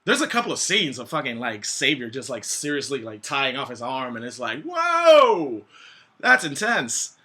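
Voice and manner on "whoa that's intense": loud, high-pitched gasp of shock; shocked exclamation. Retold or imitated. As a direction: blurt it out, stunned. Reacting to Xavier shooting up.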